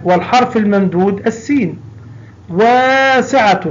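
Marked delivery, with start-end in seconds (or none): 2.5-3.6 واسعة slow with a long aa; stretched waa. Shown as a demonstration. Teacher drawing out واسعة for the class. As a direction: hold the aa in واسعة. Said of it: "الواو".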